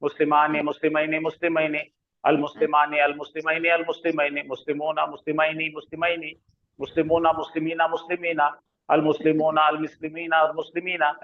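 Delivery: quick, easy Arabic drill without stopping; fast flowing recitation. Shown as a demonstration. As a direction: rattle off the forms smoothly. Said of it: "ऐसा, ऐसा, ऐसा पढ़ना". (फटाफट बहती हुई अदायगी)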